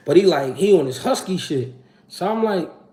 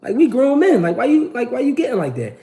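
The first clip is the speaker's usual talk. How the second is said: irritated; 8 semitones higher than usual. Retold or imitated. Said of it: "I'm like".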